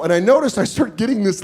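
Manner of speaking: ordinary delivery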